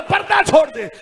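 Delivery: impassioned retold villain's order; forceful order. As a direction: demand it sharply the third time. Third, forceful repeat of order.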